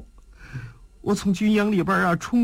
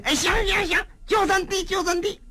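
higher, quick happy yes; eager agreement; 11 semitones higher, faster than usual. Acted. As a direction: jump at the deal gladly. Jia Ming eagerly agrees.

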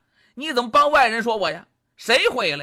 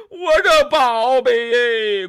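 拖长哭腔喊宝贝，放慢; 心疼宝贝，慢慢哭着喊; 2 semitones higher, 5 dB louder, much slower than usual. Acted. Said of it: "这俩都哭了". (赤精子心疼宝贝，拖着哭腔)

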